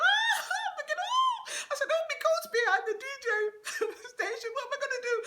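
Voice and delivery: very high, loud, fast panicked squeal through laughter; panicked laughing. Retold or imitated. Panicked girl laughing in fear.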